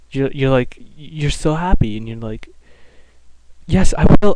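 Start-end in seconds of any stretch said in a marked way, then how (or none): 3.7-4.3 mock eager yes. Eager answer to the professor.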